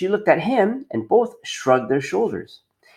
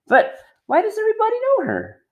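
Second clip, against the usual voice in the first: much higher puzzled small voice; Punchinello voice. Acted. Punchinello questioning the mayor's wife, high and puzzled. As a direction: ask like a curious little wooden boy.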